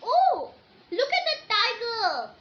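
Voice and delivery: high, loud, excited cry of surprise; excited exclamation. Shown as a demonstration. Teacher showing pupils an exclamation, excited. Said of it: "See, how you don't have to read".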